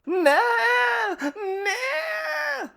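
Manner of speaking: a high, sobbing cry of no; crying shout. Acted. Friend accused of being a prep, crying out no. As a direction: wail the denial, voice breaking up.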